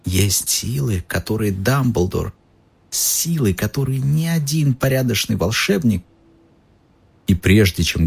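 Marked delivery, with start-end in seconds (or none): 0.0-6.0 hushed whisper. Acted. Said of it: "голос Добби понизился до шёпота".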